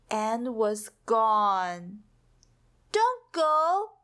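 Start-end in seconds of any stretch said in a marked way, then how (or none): 2.9-4.0 high, pleading cry to the fading rainbow; begging. A narrator's reading. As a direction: plead as if it could hear you. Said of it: "begged Pip".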